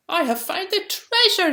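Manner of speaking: high, loud cry of discovery; thrilled little digger's cry; excited cry. A narrator's reading. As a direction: shout it out like a found treasure. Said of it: "he cried".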